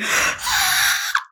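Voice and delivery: high, breathy stifled mock scream. Retelling the soup spill to listeners, mock screaming. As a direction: fake a held-back scream without really letting loose. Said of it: "start screaming. I'm just like".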